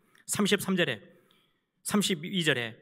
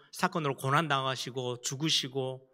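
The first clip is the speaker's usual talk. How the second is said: ordinary talk, nothing marked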